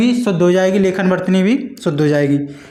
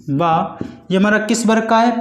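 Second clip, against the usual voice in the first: ordinary talk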